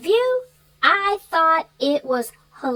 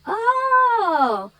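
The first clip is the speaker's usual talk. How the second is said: dawning realization; 8 semitones higher than usual. Retold or imitated. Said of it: "I was like".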